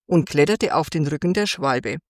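ordinary delivery

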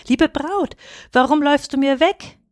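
a startled plea after her; surprised call. A narrator's reading. Taro calls after his fleeing bride, surprised and high. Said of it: "rief Taro überrascht".

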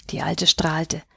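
usual speaking voice

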